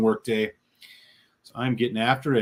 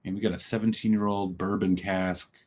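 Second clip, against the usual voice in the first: normal talking voice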